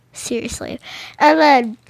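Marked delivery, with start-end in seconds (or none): 0.1-0.8 soft unvoiced whisper, under the breath; whispered aside. Dragon sitting there fed up, whispering to himself. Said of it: "being like".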